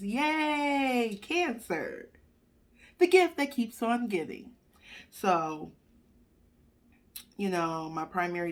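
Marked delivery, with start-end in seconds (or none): 0.0-4.5 sarcastic cheer. Real, not acted. Sarcastic about getting diabetes.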